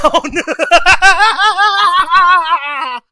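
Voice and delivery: long, high-pitched laugh; cracking up. Real, not acted. Streamer tickled by his own anthem joke, cracking up. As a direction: burst into real, sustained laughter.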